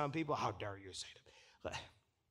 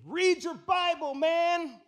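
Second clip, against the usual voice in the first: higher, louder, slow exasperated call; inner shout; 11 semitones higher, 6 dB louder, much slower than usual. Retold or imitated. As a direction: blurt it out like you can't hold it in. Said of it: "I was standing up and saying".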